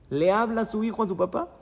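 ordinary talk, nothing marked

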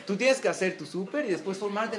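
ordinary talk

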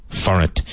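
"For it" run together like "forest" without the S; "For it" sounds like "forest" with no S. Shown as a demonstration. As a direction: link "For it" into one word, "for-it". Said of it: "if you take the word forest".

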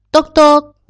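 loud high knock-knock; mock door knock; knocking sound. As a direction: rap out the knock loudly.

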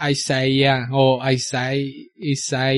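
put-on slow, English-accented tries at Isaí; imitated English accent. Showing how foreigners battle with the name, slow English accent. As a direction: imitate English speakers struggling slowly with the name. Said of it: "cómo te dirían en otros países".

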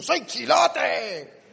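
a very slow, drawn-out "¡Soy chilote!"; proud Chilote man. Shown as a demonstration. Comedian shows the Chilote man's attitude, slow and proud. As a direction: stretch it out with swagger. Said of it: "esta es la actitud".

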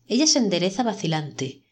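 ordinary delivery, nothing marked

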